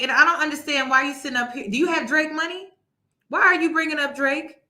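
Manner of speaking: normal talking voice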